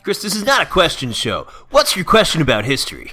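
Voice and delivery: hammed-up radio presenter losing patience; impatient radio host. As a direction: cut the caller off briskly. Radio host scolds caller.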